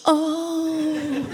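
high mocking oh, echoing the son; dad mocking his busted son. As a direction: tease him by copying his oh. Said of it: "in a mocking way that a father has a right to do".

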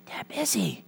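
put-on high, soft child whisper; whispering little boy. Little boy answers a telemarketer's call, whispering. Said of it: "still whispering, the little voice said".

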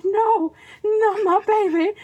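theatrical protective panic; mock motherly alarm. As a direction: cry out for your baby, hammed up. Mother acting out her alarm.

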